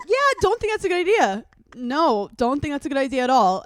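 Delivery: high, fast worried warning; warning a friend off a movie, fast and high; mock warning. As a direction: talk her out of it quickly, pitched well up.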